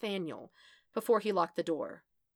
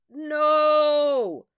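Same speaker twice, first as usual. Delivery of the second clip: long, high, loud cry of dismay; one loud, high, stretched-out NO; drawn-out yell; 9 semitones higher, 12 dB louder than usual. A narrator's reading. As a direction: wail a drawn-out no. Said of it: "distant, drawn-out".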